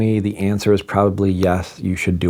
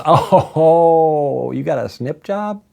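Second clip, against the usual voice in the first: amused trainer; 7 semitones higher, much slower than usual. Retold or imitated. Trainer laughing at the news.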